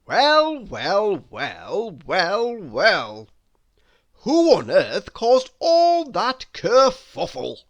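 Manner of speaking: loud, swooping, theatrical exclaiming; blackbird character. Acted. Blackbird asking mice about the commotion, loudly exclaiming. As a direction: boom it out as an astonished bird. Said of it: "said Charles the blackbird".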